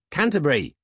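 high, loud call with falling end; impatient call. A narrator's reading. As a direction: shout impatiently for the butler. Ignored Peg calls his butler again.

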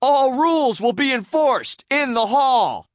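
high, loud, drawn-out decree; shouting. A narrator's reading. Hall monitor laying down rules, shouting. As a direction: shout it slowly as a grand law. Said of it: "SpongeBob shouted".

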